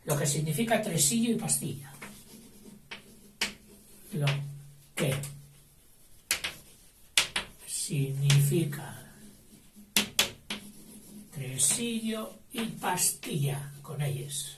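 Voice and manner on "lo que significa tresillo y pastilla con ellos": slow dictation. Shown as a demonstration. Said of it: "dictation word by word".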